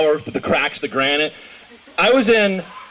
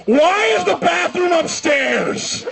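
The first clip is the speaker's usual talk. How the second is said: high, drawn-out mock wail; aggrieved old man; 13 semitones higher, much slower than usual. Old man who wet himself, high anguished holler. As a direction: cry it out as a hammed-up outraged old man. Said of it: "just pissed himself openly and was like".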